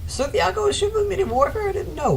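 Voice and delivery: very high, squeaky silly voice; goofy high voice. Real, not acted. Caster reading the screen in a goofy squeak. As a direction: squeak it like a cartoon.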